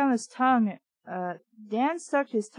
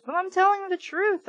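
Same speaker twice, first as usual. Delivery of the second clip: higher, pleading insistence; insisting; 8 semitones higher than usual. A narrator's reading. Trina to Dan, insisting higher. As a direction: push it up, desperate. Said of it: "I insisted".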